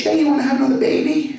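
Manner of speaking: high, fast mock wife; a hammed-up nagging wife; pushy wife. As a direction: fire the question at him brightly. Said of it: "She's like".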